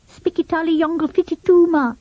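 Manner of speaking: high mock-foreign gibberish; boy bluffing in invented language; nonsense language. A narrator's reading. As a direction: rattle off made-up words like a real tongue. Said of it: "answered Fatty at once".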